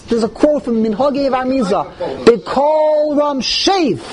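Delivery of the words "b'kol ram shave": high, very slow proclamation; mock shout. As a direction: stretch it out as a raised call. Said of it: "He shouts".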